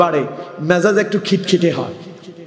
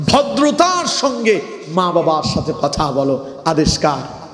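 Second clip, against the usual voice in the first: ordinary talk, nothing marked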